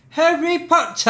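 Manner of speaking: put-on high, loud British-accented shout; over-the-top British call-out; mock British Harry Potter cry. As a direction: holler it in an exaggerated British accent.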